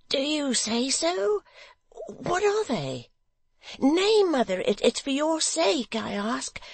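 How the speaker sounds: put-on high, eager halting young man; Barnaby's voice. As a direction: stammer a little, high and earnest.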